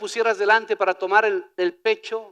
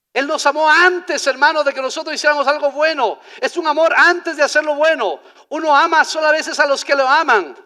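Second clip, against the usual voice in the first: raised, higher preaching voice; forceful preaching; 5 semitones higher, 7 dB louder than usual. Real, not acted. Preacher to congregation on love before good deeds, forceful preaching. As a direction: drive it home loudly to the congregation.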